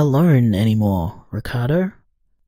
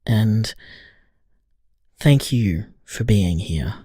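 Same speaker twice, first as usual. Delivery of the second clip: tender; 5 semitones lower than usual. Acted. Tender goodbye on voicemail.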